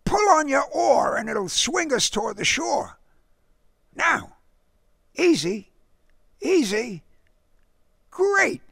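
put-on high-pitched, lively boy at camp; boy character. A narrator's reading. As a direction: voice a boy directing his friend, pitched well up.

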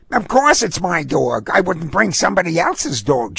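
high-pitched feisty dog owner. A narrator's reading. Dog owner answers the disdainful gentleman, feisty. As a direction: snap back feistily in a high voice. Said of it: "she said feistily".

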